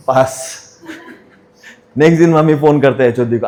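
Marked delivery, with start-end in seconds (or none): 0.1-0.6 a short word with a laugh in it; laughing answer. Real, not acted. Son tells mother he passed, laughing. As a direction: laugh it off sheepishly.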